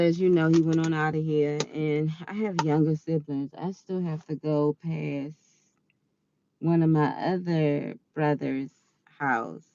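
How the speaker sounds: ordinary talk, nothing marked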